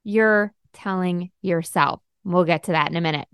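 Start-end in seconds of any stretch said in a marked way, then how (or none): 0.0-2.0 "you're telling yourself" slow, stress on "you're"; emphasized phrase. Shown as a demonstration. Coach stressing who tells the lies, slow with "you're" hit. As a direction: lean hard on "you're", slow it down. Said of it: "I really wanna emphasize that last part".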